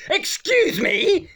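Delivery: high, drawn-out mock shock; shocked old woman. Old woman at the counter, high slow mock shock. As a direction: imitate a scandalised tweedy woman, slow and high. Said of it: "like, she was shocked".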